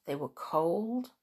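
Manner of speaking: plain reading voice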